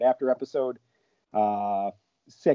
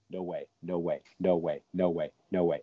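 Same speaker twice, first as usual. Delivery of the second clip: awed murmur; 6 semitones lower, 7 dB quieter than usual. Retold or imitated. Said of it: "just kinda sat there going".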